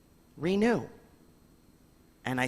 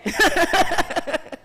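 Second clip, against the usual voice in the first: giggle; 9 dB louder than usual. Real, not acted. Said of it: "laughs at own voice".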